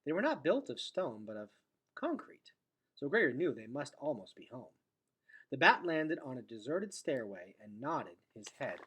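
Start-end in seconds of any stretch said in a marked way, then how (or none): none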